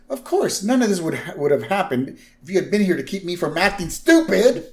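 high-pitched indignant blame; acted protest shifting the fault to the wife; Homer. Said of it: "So Homer continues".

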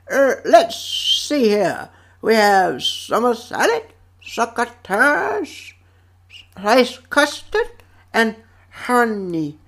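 slow, whistling cartoon rodent; imitated Gopher. Gopher lists his lunchbox to bear, slow whistly imitation. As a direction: draw it out with whistled S sounds. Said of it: "And Gopher's like".

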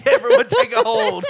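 loud call. Pretend. Sparrow boss ordering the flock.

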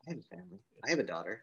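very soft, calm and even; eerily calm. Retold or imitated. Gunman calmly telling the hostages about his family, very soft. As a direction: say it softly, like nothing is wrong. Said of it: "very calmly".

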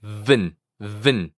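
"Vn" with no vowel between, low; blended V-N sound. Shown as a demonstration. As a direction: buzz the V straight into N in "vn". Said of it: "juntes el sonido de la V". ("Vn" unido, sin vocal, en tono grave)

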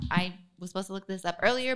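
normal talking voice

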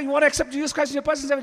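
very fast, high, loud question; rushed altar call. Retold or imitated. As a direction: blurt out the invitation in one breath.

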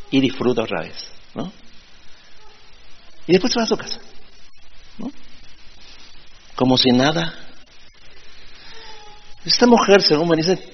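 normal talking voice

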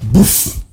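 sudden breathy "boof" burst; imitated whoosh. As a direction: puff out a mock gush sound. Imitates sudden leak in retold story.

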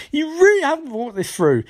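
high-pitched incredulous complaint; mock exasperation. Cisco criticising her gold gun idea, exasperated. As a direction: swoop up in disbelief.

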